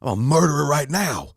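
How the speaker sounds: yelling back that he's a murderer now; mock furious yell. Actor yells at his agent in mock fury. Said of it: "I go".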